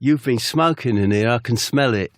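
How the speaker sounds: ordinary talk, nothing marked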